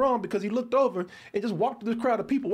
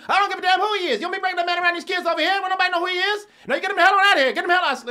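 much louder and higher, raging father; furious father shouting; 10 semitones higher, 7 dB louder, faster than usual. Retold or imitated. Father ordering son's friend off the property, raging shout. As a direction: shout him down like a dad who won't listen. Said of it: "that's the way he was talking to me".

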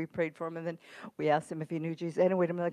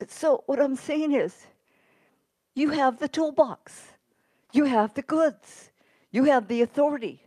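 preaching at full force; 5 semitones higher, 6 dB louder than usual. Acted. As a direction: drive each line at the congregation. Preacher exhorting the congregation.

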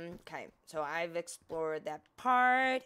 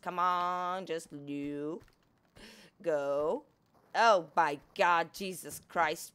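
ordinary talk

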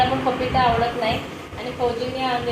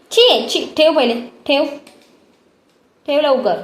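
loud scolding; 3 semitones higher, 5 dB louder than usual. Real, not acted. Mother loudly scolding the toddler to put it down.

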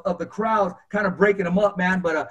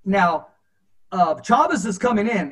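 ordinary talk

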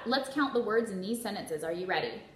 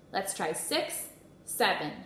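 ordinary talk

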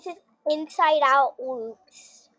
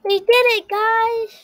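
loud happy shout of victory; excited cheer; 6 semitones higher, 4 dB louder than usual. Real, not acted. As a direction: cheer it out, thrilled. Celebrating a win in game.

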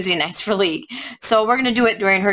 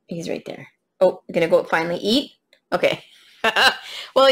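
usual speaking voice

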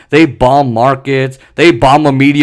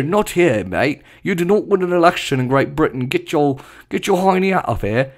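softer, hammed-up British accent; put-on British accent; 5 dB quieter than usual. Mock Briton telling Biden to get out, British accent. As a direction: play a scolding Brit, quieter. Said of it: "I'm sorry for my British accent".

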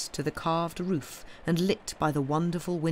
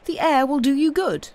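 high, loud call from above; 9 semitones higher, 6 dB louder than usual. A narrator's reading. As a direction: call it down to someone below. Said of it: "he called".

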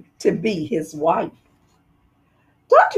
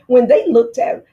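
usual speaking voice

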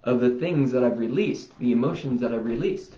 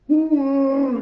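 yawning; 6 dB louder than usual. Real, not acted. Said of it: "I was yawning through some of this".

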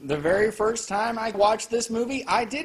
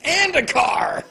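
louder, higher punchline; shout; 9 semitones higher, 7 dB louder, slower than usual. Real, not acted. Punchline outburst.

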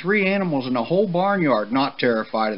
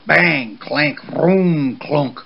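bang out the machine noises; loud, high-pitched clanking sound words; loud crash noises; 5 semitones higher, 5 dB louder, much slower than usual. A narrator's reading. Narrator voicing a clanking blue machine's arrival, loud noise words.

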